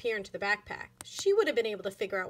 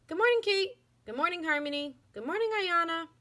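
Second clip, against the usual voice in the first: lightly voiced teacher, higher; cheerful teacher; 7 semitones higher than usual. A narrator's reading. Teacher greeting girls, lightly voiced higher. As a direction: greet each girl kindly.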